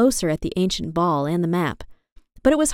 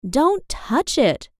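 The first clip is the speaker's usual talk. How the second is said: alarmed cry; 6 semitones higher, much slower than usual. A narrator's reading. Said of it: "cried Sarah".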